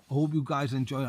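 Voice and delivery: normal talking voice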